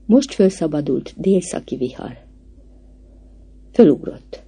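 ordinary delivery

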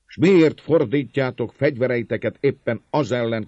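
impassioned appeal to an angry crowd; fervent. A narrator's reading. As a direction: plead with rising force. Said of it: "szólt lelkesedve".

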